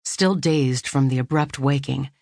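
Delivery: plain reading voice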